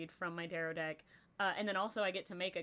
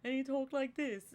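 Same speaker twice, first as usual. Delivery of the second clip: a quick playful hint of Grog's voice; Grog impression; 6 semitones higher than usual. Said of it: "it sounded like Grog from, uh, Vox Machina".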